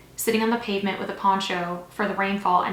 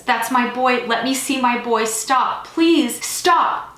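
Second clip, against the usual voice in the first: loud, high plea building higher; desperate shouting; 4 semitones higher, 7 dB louder, much slower than usual. A narrator's reading. Father pleads to see his boy, desperate shouting. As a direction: beg at a shout, rising to the last stop. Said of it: "The shouts continue".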